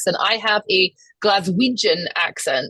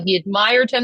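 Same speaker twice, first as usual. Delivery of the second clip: ordinary delivery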